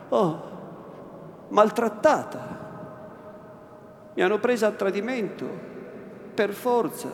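frightened pleading. Acted. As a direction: beg through fear and outrage.

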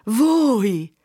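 high, loud disbelieving question; astonished exclamation. A narrator's reading. Agnese cannot believe it is Fermo, exclaiming. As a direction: cry out in amazement at who it is. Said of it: "sclamò Agnese".

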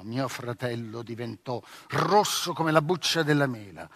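ordinary delivery

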